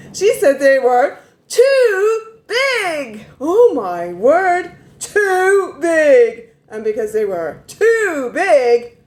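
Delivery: high, slow, loud exaggerated disbelief; mock outrage, high and drawn out; incredulous mock whine. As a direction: stretch it out high in outraged mockery. Outraged mocking of complaint.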